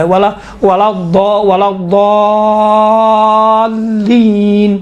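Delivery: الضالین recited with heavy sound and very long "aa"; slow tajweed demo, الضالین thick and stretched; thickened lam, long madd. Shown as a demonstration. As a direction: build up to الضالین, then hold it on one long note. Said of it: "لام کا تلفظ تھوڑا سا موٹا".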